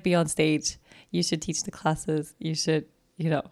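ordinary delivery

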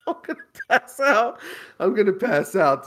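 high, breathless laughing words; helpless laughter. Real, not acted. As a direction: gasp it out mid-laugh. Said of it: "That's the fucking hilarious shit".

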